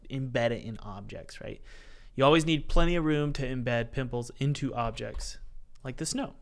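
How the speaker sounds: normal talking voice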